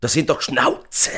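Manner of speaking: heated, high-pitched protest; outraged shouting. A narrator's reading. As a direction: protest loudly in disbelief. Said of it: "schrie Amir".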